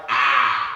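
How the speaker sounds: sudden loud "aah" of stress; mock outburst of grief, short and loud; stressed cry. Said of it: "grief. They'd have been kinda like".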